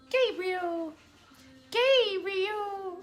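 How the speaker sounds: calling out. Real, not acted. Mother calling her son Gabriel over, high drawn-out call. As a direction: sing the name out high, stretching it.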